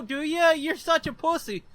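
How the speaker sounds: normal talking voice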